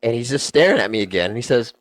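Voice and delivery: ordinary talk, nothing marked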